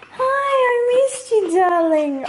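high, drawn-out cooing greeting; slow, doting reunion coo; pet-talk voice. Real, not acted. Greeting her dog.

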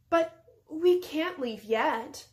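wide-eyed innocence. A narrator's reading. Princess stalling the wizard, wide-eyed innocent. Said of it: "opening her eyes very wide".

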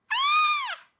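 very high, loud burning gasp "Ah!"; sharp gasp. A narrator's reading. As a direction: let the fiery cider burn the throat. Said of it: "gasped the smallest fox".

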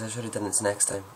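ordinary talk

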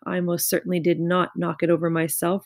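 ordinary delivery

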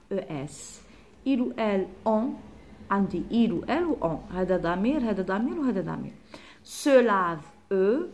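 ordinary talk

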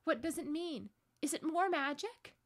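high, anxious questioning; confused, frightened girl; bewildered. A narrator's reading. Susan bewildered, crying out questions.

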